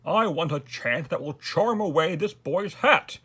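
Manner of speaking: high, loud royal demand; demanding king. A narrator's reading. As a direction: order it emphatically, punching the last word. King orders the magicians.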